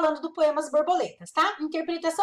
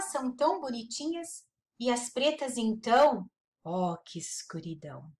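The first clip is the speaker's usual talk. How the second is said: slow, soft poem reading; poem recital; 3 semitones lower, 3 dB quieter than usual. Acted. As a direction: read the verses gently, savoring them. Teacher reads Vinícius poem aloud. (recitando poema devagar e suave)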